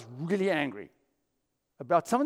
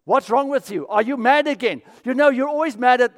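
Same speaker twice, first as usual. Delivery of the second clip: louder, higher accusing questions; mock accusing snap; 6 semitones higher, 8 dB louder than usual. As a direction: play the nagging partner. Acted accusing spouse quote.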